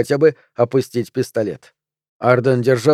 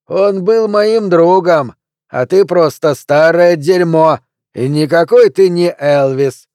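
loud, high, slow insult; drunken angry shout; 5 semitones higher, 6 dB louder, slower than usual. Acted. Drunk heckler yelling at Pelvis, high, loud and slow.

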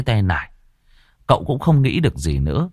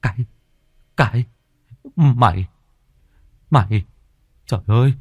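panicked; 3 semitones higher than usual. Acted. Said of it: "Cậu Trinh hốt hoảng".

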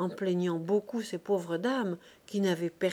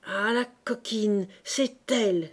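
sharp accusing outcry, louder; crying out; 4 dB louder, slower than usual. A narrator's reading. Young woman crying out at her mother, accusing. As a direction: cry out at the guilty one. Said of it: "s'écria la jeune femme".

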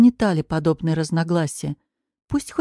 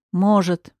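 plain reading voice

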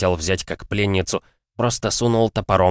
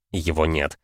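ordinary delivery, nothing marked

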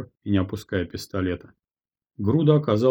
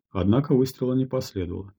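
usual speaking voice

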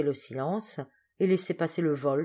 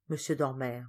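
ordinary delivery, nothing marked

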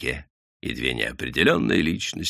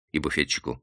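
ordinary delivery

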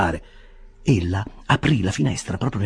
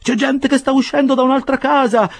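much higher and louder frantic begging; panicked plea; 6 dB louder than usual. A narrator's reading. Half-dressed Winkle begging the bearers to hide him, frantic. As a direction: beg to be hidden, in a panic.

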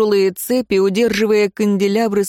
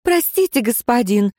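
much higher, light and quick; chirping; 5 semitones higher than usual. Acted. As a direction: twitter an apology. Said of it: "защебетала она".

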